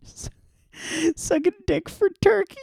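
very high stammering falsetto; squeaky falsetto. Acted. Friend joins the bit in a very high squeaky voice. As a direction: squeak it out in a tiny cartoon voice.

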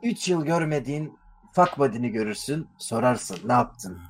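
low, soft commercial narrator; ad voiceover. Pretend. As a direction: read it like a moody beer ad. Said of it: "falan gibi böyle".

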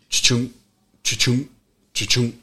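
quick repeated mouth-sound of a lock chime; imitating car lock chirp. Explaining the truck's locks, mimicking the chirp in a rhythm. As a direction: rattle off the chirp three times. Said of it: "So it goes".

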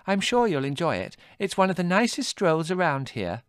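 put-on high, sugary girl's voice; sweetly fluting. A narrator's reading. Margot charming Adrian into the walk, sweet. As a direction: lay on the charm. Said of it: "fluted Margot".

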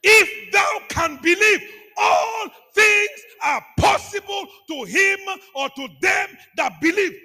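high, loud, drawn-out words with pauses; full-force scripture declamation. Real, not acted. Preacher leading the church in the verse, loud declamation. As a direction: proclaim every word of the verse slowly and forcefully.

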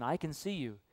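a soft, low voice in a dark house; very quiet voice. A narrator's reading. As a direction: say it quietly, like someone hidden watching. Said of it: "he hears the voice again".

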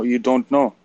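usual speaking voice

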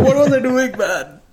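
high, loud mock scolding of a younger self; exasperated, half-laughing scold. Looking back at a crybaby self, a mock high exasperated scold. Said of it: "I just remember, like".

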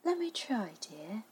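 very soft, soothing wife; placid. A narrator's reading. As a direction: offer help calmly and quietly. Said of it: "Miss Darling was placid".

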